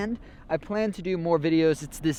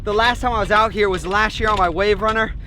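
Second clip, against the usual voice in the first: ordinary talk, nothing marked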